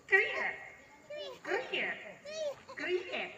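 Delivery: imitated squeaky creak, repeated; showing his wife the creaking pump sound; creaking noise. Shown as a demonstration. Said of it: "Nek gak iso tak kei contoh".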